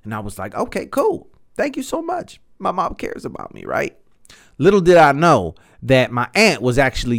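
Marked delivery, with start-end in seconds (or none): 0.5-3.6 high, sugary grateful voice; mock cheerful thanks. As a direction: play it up as an overly sweet thank you. Mock grateful reply to mom.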